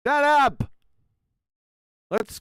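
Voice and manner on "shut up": high, loud outburst; sudden loud silencing shout; exasperated shout. Real, not acted. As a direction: yell to cut them off. Cuts across the others.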